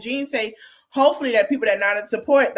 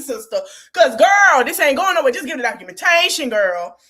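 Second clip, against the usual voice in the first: sassy fed-up plea; 4 semitones higher, 6 dB louder than usual. Retold or imitated. Voicing Quad's fed-up plea.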